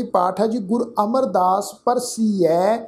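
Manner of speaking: ordinary talk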